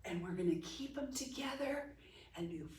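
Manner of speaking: soft unvoiced whisper. Real, not acted. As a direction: whisper it to the class. Teacher drops to a whisper.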